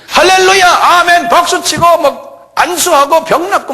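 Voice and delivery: mock Pentecostal fervor. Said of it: "이런 게 오순절 스타일".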